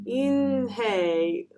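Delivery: "Eunhye" slowly, opening like "een"; trying the name. A narrator's reading. Classmates attempting "Eunhye", drawn out with an "ee" start. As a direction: stretch "Eunhye" into a tentative "een-hay". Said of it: "Everyone tried to say it".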